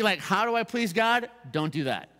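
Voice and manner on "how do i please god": higher-pitched, lightly voiced question; imagined questioner. Pastor voices someone wanting to please God, higher questioning. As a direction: ask it as an eager listener would, pitched up.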